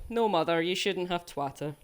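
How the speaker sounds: ordinary talk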